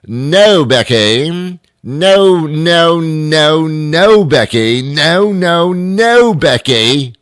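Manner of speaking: loud, high repeated no; mock angry refusal. Man yelling no at a robocall on the phone. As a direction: shut the robocaller down hard.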